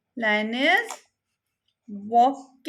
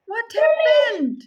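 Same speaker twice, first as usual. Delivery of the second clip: higher, louder startled question; surprised gasp; 7 semitones higher, 5 dB louder than usual. Real, not acted. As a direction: gasp and ask what happened. Gasp at lion waking.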